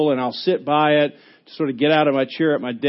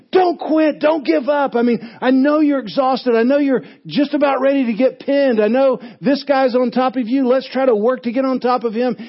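higher, urgent coach shouting from the corner; coach yelling; 9 semitones higher than usual. Retold or imitated. Wrestling coach to his pinned wrestler, higher and urgent. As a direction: yell encouragement to an exhausted wrestler. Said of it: "The, the coach is yelling".

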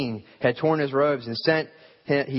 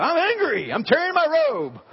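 mock angry. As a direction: play it big, a silly tantrum. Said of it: "But if I did and I got angry".

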